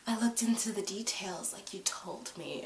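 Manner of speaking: hushed whispered confession; whispered quote, very soft. Retold or imitated. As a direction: whisper it like a secret. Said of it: "And she was like".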